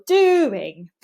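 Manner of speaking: "doing" drawn out with a strong, loud first syllable; weighty stress on "doing"; stressed word. Shown as a demonstration. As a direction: hit DO in "doing" hard. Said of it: "stress on the what".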